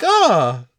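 a mock sleeper coming round contentedly; waking up. Imitating the sleeping powder victims waking up, lightly acted refreshed sigh. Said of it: "waking up being like".

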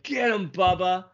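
put-on high, eager kid cheering; lightly voiced excited girl. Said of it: "she's like".

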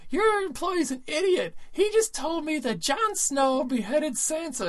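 high, slow mock whine; hammed-up tearful tattling; crying complaint. Imitating prank victim complaining to supervisor, wailing high. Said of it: "started crying and screaming at him".

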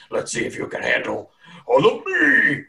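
hammed-up giant taunt; challenging giant. Giant warms up to charge, taunting and building. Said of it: "warm-up footsteps and says".

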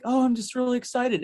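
high-pitched eager newcomer; imitating his own giddy excitement; excited. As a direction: gush a little, pitch lifted well up. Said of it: "and I'm so excited".

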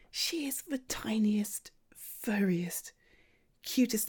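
slow adoring whisper; gushing whisper. Acted. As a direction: melt over the kitten quietly. Gushing over kitten quietly.

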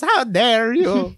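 high, slow, offended little gourd; Mister Lunt voice. Retold or imitated. Retelling the commentary to a friend, high slow offended Mister Lunt voice. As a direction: ham up the tiny gourd's outrage, stretched out. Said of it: "And his voice is just the".

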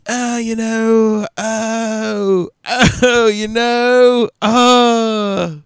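high, slow, whining imitation; whiny mock voice. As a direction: drag it out like a whiny friend. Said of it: "you're like".

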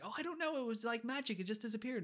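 high-pitched put-on kid voice pleading innocence; imitated protesting boy. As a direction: play a boy insisting he knows nothing. Voices Harry protesting his innocence.

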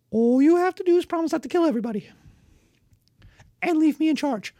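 high, loud, quick persuading; a lord hurriedly selling taxes to barbarians; eager lord pitch. Acted.

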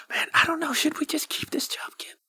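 whispered aside; whispering. Acted. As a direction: lean in and whisper it.

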